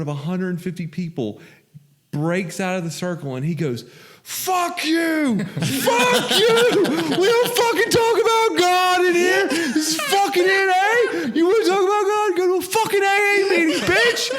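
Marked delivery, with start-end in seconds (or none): 4.2-14.3 much higher and louder, raging yell; angry atheist exploding at a newcomer mid-meeting, furious high yell; furious shout. Retold or imitated. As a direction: scream it at the whole circle. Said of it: "He's just a super angry guy".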